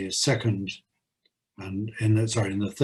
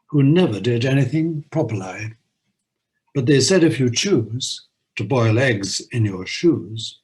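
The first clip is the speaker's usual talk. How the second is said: lively poem recitation with a bouncy beat; limerick reading; 5 dB louder than usual. Acted. Host reading the Norway limerick aloud, lively recitation. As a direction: deliver the nonsense rhyme with relish.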